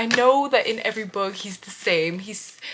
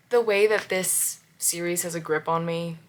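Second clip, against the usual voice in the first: normal talking voice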